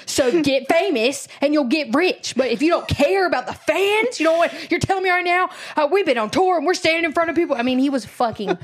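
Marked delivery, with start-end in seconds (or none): 0.1-7.6 mock drunk rant. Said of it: "And he's like".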